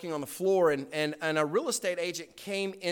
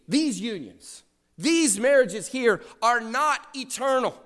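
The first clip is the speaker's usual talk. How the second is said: preaching at full force; 9 semitones higher, slower than usual. Acted. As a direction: proclaim it to the whole congregation. Preaching on eternal marriage.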